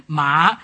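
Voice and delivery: rising "Ma", Mandarin second tone. Shown as a demonstration. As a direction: lift the pitch steadily through "Ma", like a question. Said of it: "has a rising inflation".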